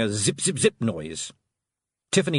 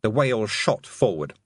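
ordinary delivery, nothing marked

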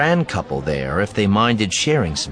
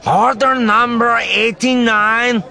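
high, loud, drawn-out alien growl; loud creature voice pitched way up; 7 dB louder, slower than usual. A narrator's reading. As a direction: growl it out slowly like an alien counter clerk. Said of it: "the Capoan growled at me".

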